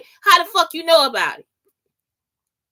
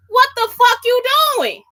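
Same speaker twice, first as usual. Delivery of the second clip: high-pitched exasperated demand; acted-out confrontation, pitch jumping up; indignant challenge; 8 semitones higher, 3 dB louder than usual. Speaker confronts those doing that to dudes, indignant.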